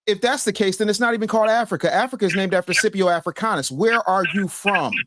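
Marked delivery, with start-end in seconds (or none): 3.7-4.9 slow, deliberate demand, each word apart; insistent questioning. Real, not acted. Host pressing an evasive caller, slow and insistent.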